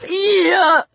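high cry through a blocked nose; nasal, stuffy. A narrator's reading. Lang gasps at Peter through a broken nose, nasal. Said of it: "Lang's voice was nasal, stuffy".

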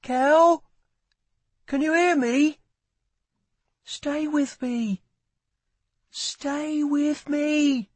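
high, desperate calls receding into the distance; high, drawn-out distant pleading; voice getting further away. A narrator's reading. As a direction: call from far away, slowing and fading after the first cry. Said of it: "Lila's voice was getting further and further away".

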